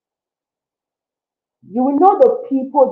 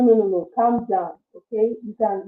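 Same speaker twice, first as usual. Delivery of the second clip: ordinary talk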